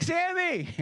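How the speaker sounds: high-pitched yell of a name; mock yell. Man interrupting the preacher's sermon, mock yell. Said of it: "he interrupts and he yells".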